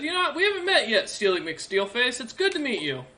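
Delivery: put-on high, friendly young man's greeting; young soldier. Young soldier greeting Bastion with a handshake, high and friendly. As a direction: introduce yourself in a high, eager voice. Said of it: "he goes".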